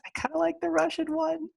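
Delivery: mock-swoon over the Russian accent; mock dreamy voice. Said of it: "'cause I was like".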